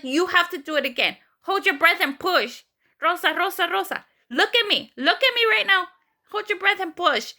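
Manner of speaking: high, insistent orders, half yelled; imitated yelling. Doctor ordering a patient in labor to push, retold yelling. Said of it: "he pretty much yelled at me".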